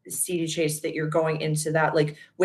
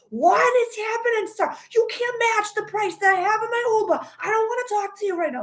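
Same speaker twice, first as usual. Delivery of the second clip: much higher, Boston-tinged mock yell; Boston came out. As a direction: snap back in a high Boston rant. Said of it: "my Boston came out and I'm just like".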